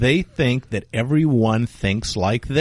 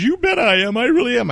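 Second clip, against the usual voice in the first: mock frightened insistence; 11 semitones higher, 3 dB louder than usual. Lady insisting she is frightened.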